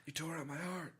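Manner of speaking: high, soft mock-heartbroken impression; May. Co-host parodies a wronged girlfriend, high and quiet impression. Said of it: "My best May impression".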